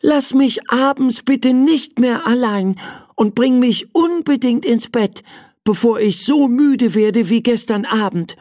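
put-on high, plaintive child plea; sighing little prince. Prince begging servant after awful night, plaintive high sighing. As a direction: plead pitifully, ending on a sigh. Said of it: "seufzte der kleine Prinz".

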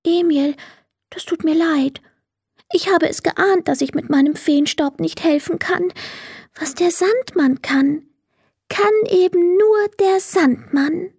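high, dejected little fairy voice; sad apology. A narrator's reading. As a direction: apologise in a small, high voice, resigned by the end. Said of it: "entschuldigte sich sofort und sagte traurig".

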